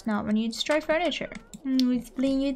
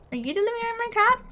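excited; 9 semitones higher, faster than usual. Real, not acted. Police bring the adopted cat.

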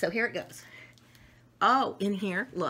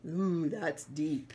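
mock impressed; 3 semitones lower, 4 dB quieter, slower than usual. Character impressed.

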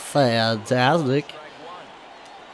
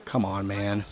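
normal talking voice

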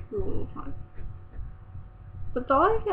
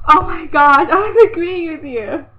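loud laughing exclaim; 5 semitones higher, 12 dB louder than usual. Real, not acted.

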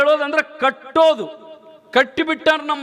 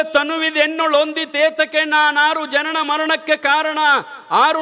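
raised recital rushed in a single breath; poem in one breath; 5 semitones higher than usual. Shown as a demonstration. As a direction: chant the verse without pausing for air. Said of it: "ಇನ್ನಟ್ ಹೇಳಲೆ ಸಾಕ ಮತ್ತ ಒಂದ ಉಸುಲ್ಲೆ".

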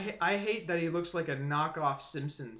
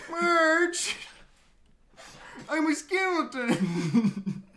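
cartoon voice; 9 semitones higher, much slower than usual. Cartoon voice bit continues.

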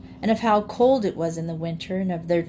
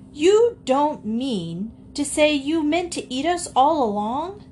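higher, drawn-out, shocked protest; alarmed cry; 8 semitones higher than usual. A narrator's reading. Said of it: "they cried".

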